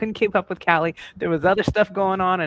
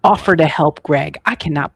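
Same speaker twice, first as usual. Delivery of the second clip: loud, hammed-up scolding; mock outrage; 3 semitones lower, 8 dB louder than usual. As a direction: scold her like she betrayed you, over the top. Mock outrage at friend's offer.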